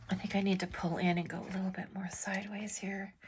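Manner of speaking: a hushed whisper, quick, concentrating on the pen; whispered to herself. Real, not acted. Artist whispering to herself mid-stroke. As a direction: whisper it under your breath while you work the lines. Muttering to herself while drawing.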